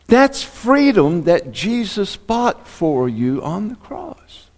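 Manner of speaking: high, slow preaching build. Acted. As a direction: drive the promise home.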